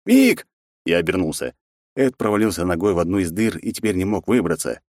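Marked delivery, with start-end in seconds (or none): 0.1-0.5 high, loud desperate call; cry for help. Acted. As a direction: yell his name in panic. Friend cries out for help.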